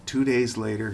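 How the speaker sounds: usual speaking voice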